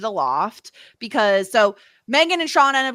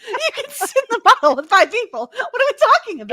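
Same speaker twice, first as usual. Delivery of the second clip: higher, louder, laughing disbelief; incredulous; 10 semitones higher, 6 dB louder, faster than usual. Real, not acted. Podcaster mocking the five-player rule, higher, louder, laughing. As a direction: squeal at how absurd it is.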